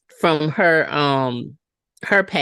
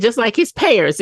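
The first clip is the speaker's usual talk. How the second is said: lightly voiced quote, pitched up with stress on his; a touch higher retold line, "his" stressed; mock quote, higher; 6 semitones higher than usual. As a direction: repeat the line a little higher, leaning on "his". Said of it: "He said".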